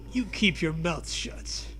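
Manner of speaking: normal talking voice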